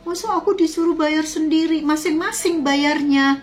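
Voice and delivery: very high, mock-wailing protest; tangisan tiruan. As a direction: imitate an aggrieved friend's tearful outrage. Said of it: "Dia nangis-nangis". (protes merengek meniru teman menangis)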